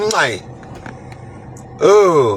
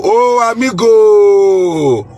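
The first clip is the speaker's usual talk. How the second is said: long, slow, louder "Oh, amigo" sung out; excited drawn-out greeting; 4 semitones higher, 5 dB louder, much slower than usual. Real, not acted. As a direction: warmly hail your amigo, slow and loud. Greeting viewers in many languages.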